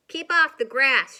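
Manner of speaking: very loud angry shout. Acted. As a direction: bellow it at the cowboy. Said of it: "shouted Hank".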